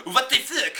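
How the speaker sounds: hammed-up French lady's exclamation; French accent. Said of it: "the French lady goes".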